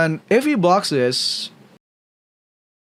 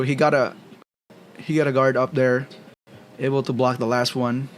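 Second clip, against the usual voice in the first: usual speaking voice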